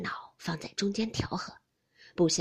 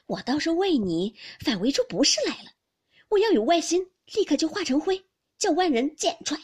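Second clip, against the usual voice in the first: loud, high, agitated protest; anxious, urgent; 7 semitones higher, 8 dB louder than usual. A narrator's reading. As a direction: swear innocence in desperate haste. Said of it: "宝玉急得说道". (急切地高声辩白)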